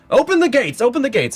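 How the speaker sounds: high, loud order; shouted command. Acted. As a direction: call out to open the gates. Guard commands gates opened.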